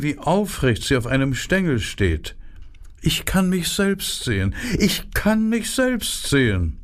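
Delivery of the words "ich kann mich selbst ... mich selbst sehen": much higher, preening flower voice; self-admiring flower, singing her own praise; vain narcissus. A narrator's reading. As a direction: admire yourself, way up high. Narcissus repeats its boast.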